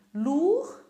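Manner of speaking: usual speaking voice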